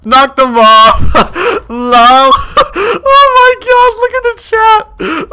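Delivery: high, loud excited yelling and laughing; excited shriek. Real, not acted. Streamer celebrating a knockoff, high excited squeals. Excited win against opponent.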